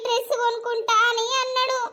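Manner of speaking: normal talking voice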